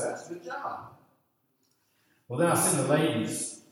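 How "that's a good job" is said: praising voice. Retold or imitated. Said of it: "somebody say".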